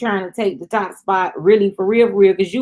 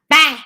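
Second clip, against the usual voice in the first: imitated slap sound; 8 semitones higher, 5 dB louder than usual.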